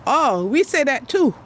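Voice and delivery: quoted exclamation. Retold or imitated. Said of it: "But then I say".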